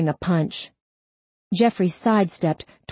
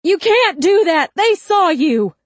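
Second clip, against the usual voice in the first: high, loud frantic protest; panicked scream; 13 semitones higher, 7 dB louder than usual. A narrator's reading. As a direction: keep screaming, voice way up. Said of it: "Wright screamed".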